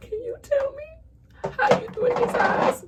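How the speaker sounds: very high, slow, pleading question. Retold or imitated. To [name], in a high, pleading, begging voice. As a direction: ask it up high and stretched out. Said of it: "I just wanna call her and be like".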